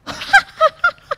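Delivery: high proud cackle of a smug bird; high boastful bird laugh; arrogant laugh. A narrator's reading. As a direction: laugh down at the little sparrow. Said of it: "dia tertawa".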